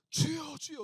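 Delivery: loud, high cry of prayer. Shown as a demonstration. Preacher shows praying alone in church, crying out. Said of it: "외치세요".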